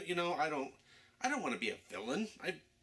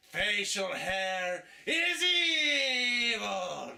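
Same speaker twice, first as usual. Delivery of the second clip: grand villain proclamation; 10 semitones higher, 7 dB louder, much slower than usual. Acted.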